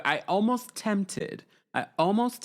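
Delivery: playful mock-British accent; put-on English accent. Teasing viewers before a movie date, in a put-on English accent. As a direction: try on a posh English accent. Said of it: "I'm going to speak like this".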